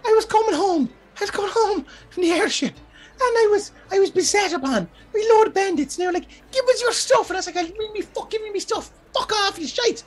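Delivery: very high falsetto character voice; Grelda. Acted. Woman explains to the party what happened, high falsetto. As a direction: tell it haltingly in a high, squeaky voice. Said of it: "Grelda's like".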